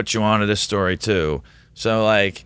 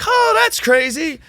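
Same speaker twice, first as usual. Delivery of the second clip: much higher, slow, astonished; amazed colleague; 4 dB louder than usual. Retold or imitated. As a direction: stretch it out in disbelief. Colleagues amazed at his prediction.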